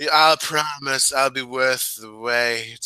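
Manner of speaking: slow, drawn-out sensual tease; sensual promo. Pretend. Wrestler promising fans her debut, sensual. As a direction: linger on each word, teasing the audience. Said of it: "That's sensual".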